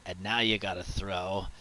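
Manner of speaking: ordinary talk, nothing marked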